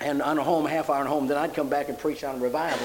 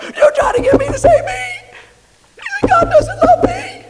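very high, loud, shouting through a laugh that cracks; a woman finally blurting her deepest fear; anguished cry; 12 dB louder than usual. Retold or imitated. Woman breaks down, retold scene.

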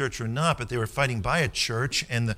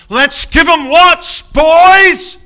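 much higher, much louder, slow battle cry; rallying yell; 17 dB louder, much slower than usual. Retold or imitated. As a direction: bellow it like a parson charging into the fight. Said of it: "he yelled at the guys".

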